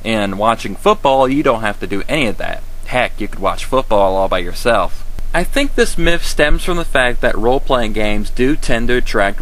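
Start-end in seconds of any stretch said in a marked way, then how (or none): none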